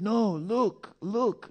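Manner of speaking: high, eager insistence; urgent pointing. Retold or imitated. Devotee pointing out the cannabis, high urgent insistence. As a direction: urge him to look. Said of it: "He said".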